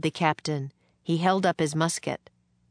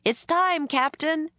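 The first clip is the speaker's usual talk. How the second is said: high, raised far-off hail; called from afar; 12 semitones higher than usual. A narrator's reading. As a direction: call up high to someone along the river. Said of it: "someone called from up the river".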